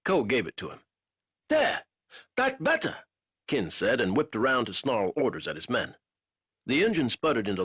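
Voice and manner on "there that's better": raised, triumphant boatman's voice; a greedy man happy with his cash; lightly voiced satisfied boatman; pleased boatman. A narrator's reading. Kin gets his money.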